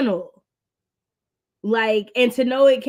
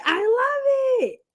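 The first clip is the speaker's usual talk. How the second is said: gushing; 14 semitones higher, much slower than usual. Real, not acted. Gushing to a reader.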